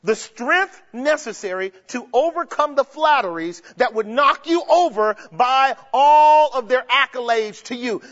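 ordinary talk, nothing marked

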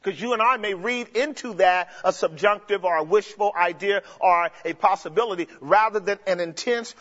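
normal talking voice

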